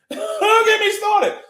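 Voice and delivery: high, slow, loud yell with a laugh; hyped high laughing yell; laughing shout. Real, not acted. Laughing outburst.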